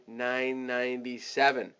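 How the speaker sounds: "nine ninety-seven" in two drawn-out parts, understated; small-sounding price. Shown as a demonstration. Coach showing how to say the discount price, understated. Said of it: "So you wanna say".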